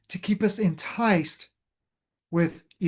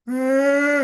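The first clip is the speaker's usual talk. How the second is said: high, loud mock baby whimper; a baby starting to cry, mimicked; 7 semitones higher, 4 dB louder than usual. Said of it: "and start crying".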